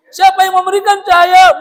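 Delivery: loud, high repeated challenge; trainer's loud question. Retold or imitated. Seminar trainer pressing the crowd, loud and high. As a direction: hammer the question again at the audience.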